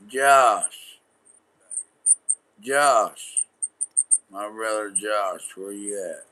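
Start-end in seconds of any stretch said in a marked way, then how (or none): 0.1-3.4 loud, raised holler with a falling end; real call across a distance. Real, not acted. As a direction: shout the name to find someone. Calling out for Josh.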